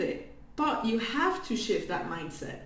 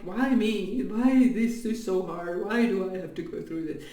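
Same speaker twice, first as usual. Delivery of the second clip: lower, lightly mocking self-pitying whine; 3 semitones lower than usual. Mocking a self-pitying viewer's lament, lightly whiny. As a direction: parody a wallower's lament. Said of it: "self-pity moments of, like".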